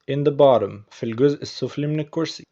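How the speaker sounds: ordinary talk, nothing marked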